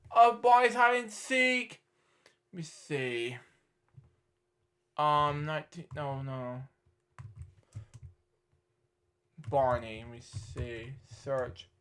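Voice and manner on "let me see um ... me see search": low, very soft murmur; talking to oneself. Real, not acted. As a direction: murmur absently while searching. Talking to self while searching.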